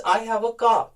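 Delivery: "car" in a British accent as "cah", no r; British accent demo of "car" without the r. Shown as a demonstration. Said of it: "जब हम British की बात करते हैं".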